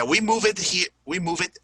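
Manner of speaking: "move it" standing in for moved, two syllables; mispronounced "moved". Shown as a demonstration. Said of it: "it doesn't sound right".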